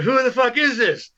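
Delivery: high-pitched excited question; retold amazed demand. Musician hearing a demo wants to know the band, amazed. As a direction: imitate someone astounded, pitch jumping up.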